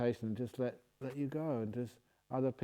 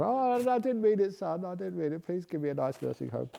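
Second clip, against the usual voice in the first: pleading father; 6 semitones higher than usual. Pretend. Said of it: "You tell him that".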